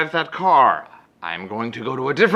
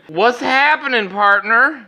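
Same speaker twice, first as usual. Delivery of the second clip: slow, high mock cowboy drawl of a greeting; 10 semitones higher, 4 dB louder, slower than usual. Comic greeting the clerk as a mock cowboy. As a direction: play a jokey cowboy. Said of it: "My response".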